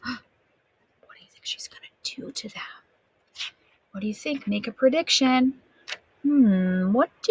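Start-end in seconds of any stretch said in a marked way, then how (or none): 0.0-2.6 breathless, hushed question; whisper. Real, not acted. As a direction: lean in and whisper the suspense. Suspense question to listeners.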